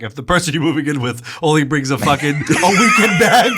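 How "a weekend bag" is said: laughing. Real, not acted. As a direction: drag it out while cracking up. Mocking partner's tiny luggage.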